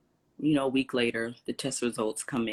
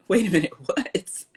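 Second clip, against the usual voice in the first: laughing disbelief; 8 semitones higher, 6 dB louder than usual. Retold or imitated. Shocked dad is nearby.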